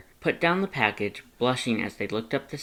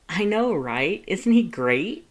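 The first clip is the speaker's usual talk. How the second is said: gushing excitement; 8 semitones higher, 5 dB louder than usual. Acted. Lust gushing.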